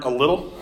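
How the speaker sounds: a little set apart and pressed; a little singled out; stress on a little. Shown as a demonstration. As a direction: lean on a little. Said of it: "I gotta emphasize the word".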